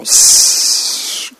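long hiss of escaping air; deflating ball hiss. Acted. Narrator sticks the pin in the beach ball, long deflating hiss. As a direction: imitate a ball going flat with a drawn-out sss.

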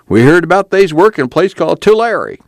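cowed, ingratiating tone; servile whine. Acted. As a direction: whine it humbly to a threatening man. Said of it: "his voice took on a servile whine".

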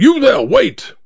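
yell. A narrator's reading. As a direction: shout to stop the driver. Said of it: "I yelled".

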